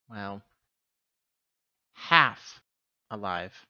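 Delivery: ordinary delivery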